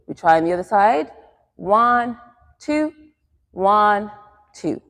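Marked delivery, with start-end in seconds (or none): 1.5-4.8 raised, spaced beats; rhythmic dance count. Shown as a demonstration. Teacher leads the turn on the other side, counting. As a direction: count the steps evenly in time.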